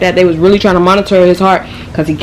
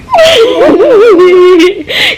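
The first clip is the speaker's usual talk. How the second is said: shrieking laugh; 14 semitones higher, 4 dB louder than usual. Real, not acted. Cracking up at own story.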